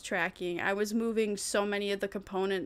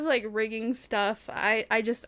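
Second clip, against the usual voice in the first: ordinary delivery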